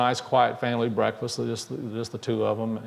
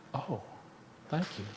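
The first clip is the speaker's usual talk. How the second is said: moved quiet thanks; 13 dB quieter than usual. A narrator's reading. Boy moved, grateful.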